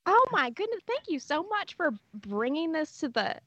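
high, gushing mom voice; impression of mom. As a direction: play your mom thrilled at the door. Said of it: "Can you do an impression of your mom?".

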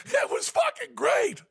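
over-the-top gushing yell; excited shout. Retold or imitated. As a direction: yell the praise. Insisting to furious Lou.

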